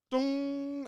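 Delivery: high, sustained ringing "dong"; imitated bell toll. Fan recreating a wrestler's entrance, long sung bell toll. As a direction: hold it out like a tolling bell. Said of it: "And then the sound of just rain".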